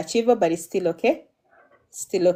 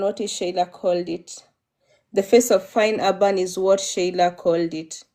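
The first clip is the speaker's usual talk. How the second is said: usual speaking voice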